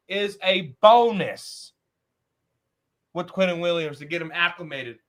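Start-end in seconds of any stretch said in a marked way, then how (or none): 0.1-1.7 BONUS slow, loud, with a falling finish; emphatic repeat. Real, not acted. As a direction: land heavily on bonus, stretched out. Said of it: "Let me say that again".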